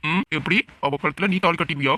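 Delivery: ordinary talk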